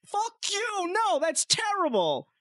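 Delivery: very high, slow, hammed-up rejection; cartoonish offended refusal; mock outrage. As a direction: squeal it in fake disgust. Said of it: "And I said".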